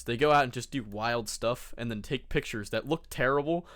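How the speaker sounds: ordinary delivery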